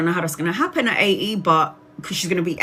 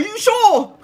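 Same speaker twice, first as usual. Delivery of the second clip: much higher, louder, laughing "are you sure"; disbelieving; 6 dB louder than usual. Retold or imitated. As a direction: squeal the doubt half laughing. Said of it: "And I was like".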